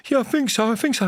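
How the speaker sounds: high-pitched imitation of a knocked-down cyclist; mock shaken victim, pitched well up; dazed cyclist. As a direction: answer in a small, high voice. Voices knocked-down cyclist answering.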